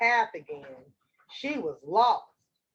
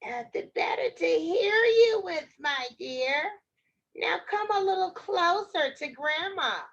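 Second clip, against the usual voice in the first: high-pitched put-on granny; wolf as Grandma; 5 semitones higher than usual. Wolf lures Red nearer, high fake granny voice. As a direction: coax in a high, fake old-lady voice.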